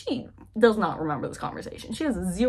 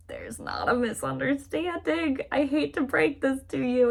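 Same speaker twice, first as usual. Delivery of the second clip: slow, higher-pitched correction in a lightly put-on voice; acted contradiction; 6 semitones higher, slower than usual. Henry correcting Catherine about the misunderstanding, pointedly. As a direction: spell it out slowly, then break the news.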